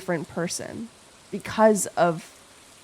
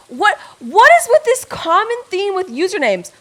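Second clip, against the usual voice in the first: excited; 9 semitones higher, 7 dB louder than usual. Real, not acted. Excited over usernames.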